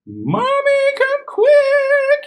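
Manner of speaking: very high, loud little monster calling; little monster calling his mom. Acted. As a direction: sneeze then call out shrilly. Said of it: "he called".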